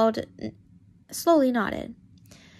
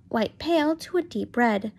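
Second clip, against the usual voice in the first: ordinary delivery, nothing marked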